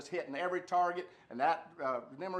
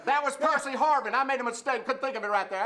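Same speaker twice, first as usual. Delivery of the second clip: loud blurt; 6 semitones higher, 6 dB louder than usual. Real, not acted. Caller blurts correction loudly.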